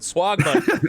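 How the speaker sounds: Swagman said with a mock Australian accent, laughing; a laughing try at Australian on Swagman. Shown as a demonstration. Host retrying the title in Australian accent. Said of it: "if you say it with an Australian accent".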